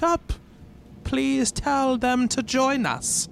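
lightly voiced eerie crone; drawn-out creepy old woman, slow at the end.

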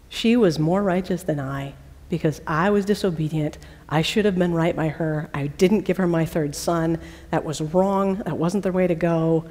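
a quiet admission of guilt; contrite. Retold or imitated. Judah confesses his wrong.

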